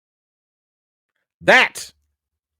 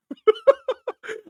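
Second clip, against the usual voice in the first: high laugh. Real, not acted. Stunned he knew his name.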